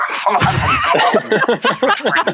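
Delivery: high-pitched talk through real laughter; can't keep the laugh out while admitting it. Real, not acted. Cracking up over own confession.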